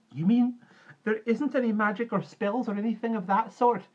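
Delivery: put-on high, loud, let-down child's question; disappointed child. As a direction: ask in a high child's voice, dismayed. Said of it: "disappointed now that my fear had subsided".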